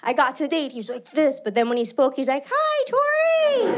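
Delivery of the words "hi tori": very high, slow, squeaky greeting; a tiny high voice that doesn't fit the man; mismatched squeaky voice. Retold or imitated. Date's voice mismatched with looks.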